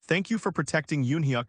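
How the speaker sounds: plain reading voice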